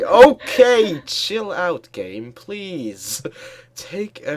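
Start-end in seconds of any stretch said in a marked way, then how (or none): 0.0-1.9 panicked. Real, not acted. Panicking in game.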